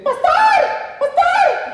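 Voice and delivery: put-on child calling the pastor from across the room, high and loud. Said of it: "escucho una voz que dice".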